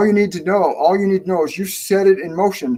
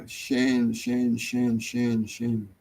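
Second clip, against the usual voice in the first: low, much softer name repeated while searching; soft mumbling to himself; 5 semitones lower, 9 dB quieter, much slower than usual. Real, not acted. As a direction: murmur the name under your breath as you scroll. Searching the chat for Shane.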